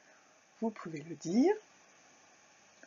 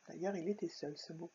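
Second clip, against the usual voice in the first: much softer, quick aside; quiet aside; 14 dB quieter than usual. Acted. Teacher to pupils, a quick quiet joke. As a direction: drop to a murmur for the joke.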